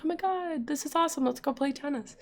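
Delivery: high, softly gushing excitement; high-pitched mock amazement, kept quiet; imagined delight. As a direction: softly mimic someone thrilled by a surprise. Imagined reaction to a gift surprise.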